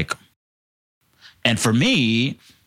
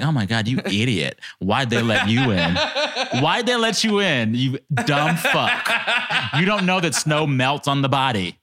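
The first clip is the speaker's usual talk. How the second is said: exasperated, mock-angry self-scolding; retold self-anger; 5 semitones higher than usual. Scolding self over melting snow, comically exasperated. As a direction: berate yourself in comic exasperation. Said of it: "made me viscerally angry".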